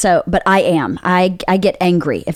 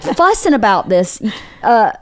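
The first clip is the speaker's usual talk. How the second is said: ordinary delivery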